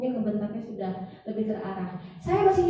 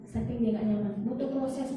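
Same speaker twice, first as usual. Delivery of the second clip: normal talking voice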